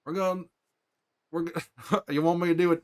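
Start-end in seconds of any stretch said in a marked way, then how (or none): none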